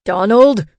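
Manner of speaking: loud outraged roar. A narrator's reading. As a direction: roar the name in protest. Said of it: "Peter roared".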